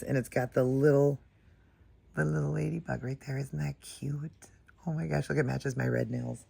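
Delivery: ordinary talk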